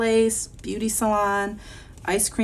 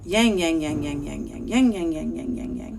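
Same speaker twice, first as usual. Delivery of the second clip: rhythmic twanging machine chant, falling away; dryer sound. Acted. Teacher voices laundromat dryers for children, rhythmic drone. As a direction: imitate a tumbling dryer in repeated waves. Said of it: "as they're drying".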